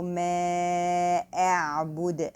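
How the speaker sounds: slow ما أعبد with clear hamza and ayn; correct recitation. Shown as a demonstration. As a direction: recite ما أعبد slowly and cleanly. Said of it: "cutting hamza".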